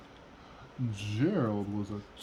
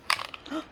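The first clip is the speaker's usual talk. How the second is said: gasp. Acted. Surprised reader gasps.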